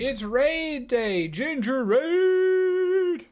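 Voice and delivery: high, loud, long-drawn raid call; whooping announcer-like cheer, soaring in pitch; excited hype shout. Real, not acted. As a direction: holler it to hype the stream, stretching the last word. Streamer hails an incoming raid.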